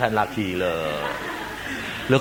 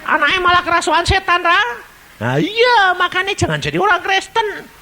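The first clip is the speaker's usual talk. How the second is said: mocking neighbours; 4 dB louder than usual. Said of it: "Lalu bilang".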